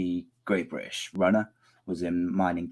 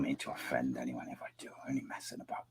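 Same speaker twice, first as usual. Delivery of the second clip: whispering; 8 semitones lower, 15 dB quieter than usual. Real, not acted. Apologetic aside.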